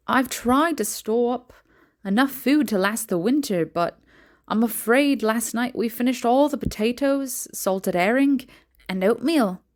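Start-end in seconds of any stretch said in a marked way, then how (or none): none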